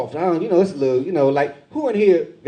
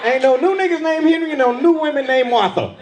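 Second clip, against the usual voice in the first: protective father rant; 8 semitones higher than usual. Retold or imitated. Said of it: "the father in me jumped out".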